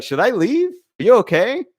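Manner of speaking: high-pitched, uneasy questions; hammed-up timid check-in, pitched high; mock unnerved. Man imagining an alarming hook-up, mock unnerved. Acting out his unnerved bedroom reply.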